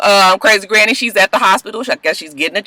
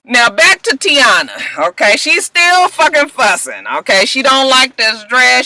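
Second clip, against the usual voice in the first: ordinary talk, nothing marked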